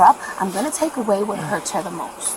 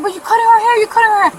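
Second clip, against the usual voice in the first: loud, high mimicry of a panicked coworker; imitated frantic objection; coworker's alarmed protest; 10 semitones higher, 9 dB louder, much faster than usual. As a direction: act out her outraged protest, pitched way up. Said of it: "And she said".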